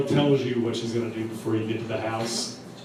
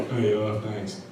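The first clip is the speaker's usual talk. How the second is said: lightly imitated cool guy, pitched low; smooth cool rapper; 5 semitones lower, 4 dB quieter than usual. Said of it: "real smooth, real cool".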